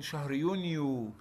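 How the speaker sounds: ordinary talk, nothing marked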